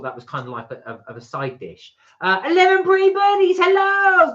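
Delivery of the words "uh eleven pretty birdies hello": much higher, bright sing-song hello; excited greeting. Real, not acted. Greeting a viewer by name.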